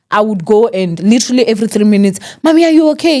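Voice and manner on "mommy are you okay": put-on high, anxious child calling mommy; a young kid checking on mom, pitched up; worried child. Child checking on mother, retold.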